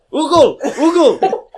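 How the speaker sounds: "Google" yelled high as "OO-gle", no first G; can't pronounce G. Retold or imitated. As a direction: holler "Google" with its opening G dropped. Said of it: "he couldn't pronounce his G".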